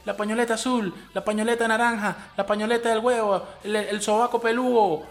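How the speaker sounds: high, mocking rally call, lightly put on; mock protest leader. A podcaster mocking feminist marchers, in a high rallying mock voice. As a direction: ham up a feminist march leader calling everyone out.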